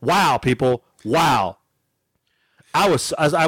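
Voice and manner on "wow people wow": ordinary talk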